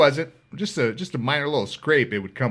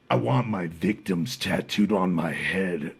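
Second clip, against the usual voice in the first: lower, slow, cold request; menacing killer; 7 semitones lower, 4 dB quieter than usual. Retold or imitated. Gang leader asking for his victims tattooed, low slow menace. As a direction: deliver it low and deadpan, like a threat.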